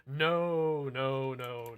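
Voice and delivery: loud, emphatic "no, no". Real, not acted. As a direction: shut the wrong guess down hard. Correcting viewer's Scotland guess.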